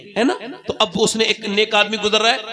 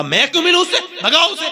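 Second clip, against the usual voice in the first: dismissive; 9 semitones higher, faster than usual. Acted. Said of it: "कहा".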